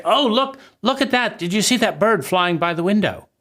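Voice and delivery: high, delighted cry to look at the bird; a thrilled child pointing out the window; excited. Acted. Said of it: "reach out empathically and excitedly and say".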